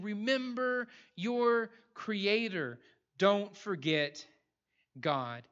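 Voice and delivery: drawn-out, weighty plea, pausing before GOD; solemn charge. Retold or imitated. Preacher passing on the Preacher's charge to us, slow and emphatic.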